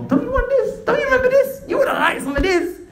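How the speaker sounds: high, loud, excited preacher's retelling; excited offering. Retold or imitated. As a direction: dangle each offer with rising excitement. Acting out Allah tempting the man.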